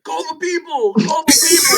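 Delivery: high-pitched imitation of a cry for help; mock panicked plea. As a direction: mimic a punched man calling for security. Imitating Dwayne calling for security.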